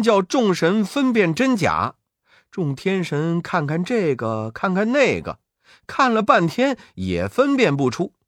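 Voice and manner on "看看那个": plain reading voice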